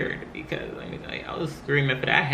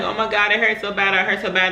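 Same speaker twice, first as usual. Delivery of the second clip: higher, urgent complaint; retold pain; 6 semitones higher, 3 dB louder than usual. New mom acting out feeling everything, in higher pained urgency. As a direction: plead in mock agony.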